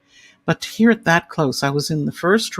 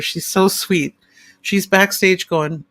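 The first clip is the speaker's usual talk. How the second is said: usual speaking voice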